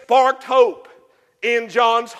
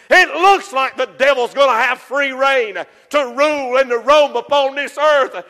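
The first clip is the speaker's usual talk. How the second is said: usual speaking voice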